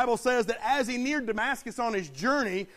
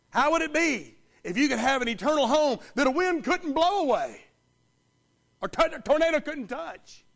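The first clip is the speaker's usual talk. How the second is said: rising preacher fervor; 4 semitones higher, 5 dB louder than usual. Acted.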